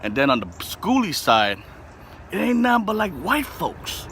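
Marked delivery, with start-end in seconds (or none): 2.3-4.1 mock voice. Mock voice about schoolie crowd.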